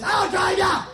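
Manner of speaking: high-pitched manic yell; Jocko. Retold or imitated. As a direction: burst out like a hyper pitchman. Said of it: "that Jocko guy".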